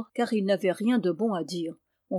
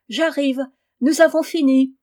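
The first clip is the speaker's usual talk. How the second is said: a louder, higher call across the field; 5 semitones higher, 8 dB louder than usual. Acted. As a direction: shout back to the father far off. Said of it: "cria-t-il".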